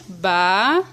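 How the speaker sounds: "Ba" held alone as one syllable; separated syllable. Shown as a demonstration. Teacher spelling out the split word, each syllable separate. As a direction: isolate "Ba" before the hyphen.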